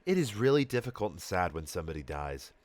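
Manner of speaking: grieving mom, holding it together; really sad. A narrator's reading. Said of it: "not crying but sounding really sad".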